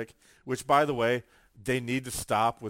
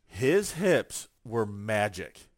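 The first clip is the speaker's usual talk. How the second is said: ordinary delivery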